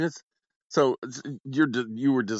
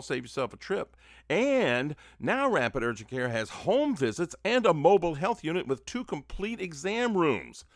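ad read; 8 semitones higher than usual. Acted. Host reads clinic ad.

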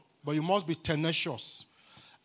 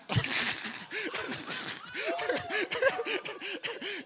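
long, high belly laugh; hearty laugh. Real, not acted. Preacher amused at own talking, long high laugh.

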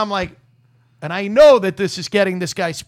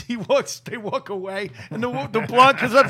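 ordinary talk

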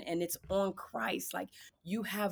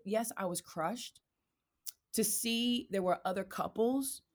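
normal talking voice

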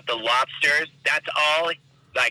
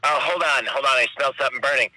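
ordinary talk

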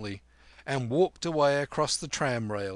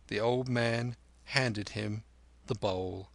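ordinary delivery

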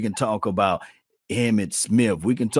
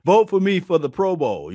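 higher, louder whiny plea for votes; mocking needy player; 8 semitones higher, 4 dB louder than usual. Host mocks Deion begging fans for Pro Bowl votes, higher whiny plea. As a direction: beg like a star who needs votes. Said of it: "You think that he had to say".

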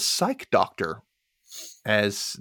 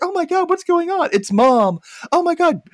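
high-pitched mock panic; panicked kids; 4 dB louder than usual. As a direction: cry out like alarmed kids, much higher. Kids panicking as mom rams door.

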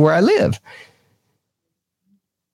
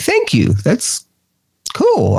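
high, bright-eyed squeal of thanks; delighted thanks; 12 semitones higher, much slower than usual. Retold or imitated. Retelling how he thanked the little girl, delighted and high. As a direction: gush it like a flattered kid would. Girl praises his ghost stuff.

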